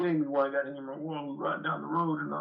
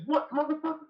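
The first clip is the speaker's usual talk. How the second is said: mock angry retort; 12 semitones higher than usual. Angry retort he almost made.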